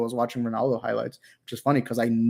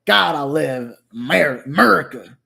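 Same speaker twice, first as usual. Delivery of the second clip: hammed-up American patriot; 6 dB louder than usual. Streamer parodying a MAGA hat patriot, hammed-up American voice. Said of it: "You say America".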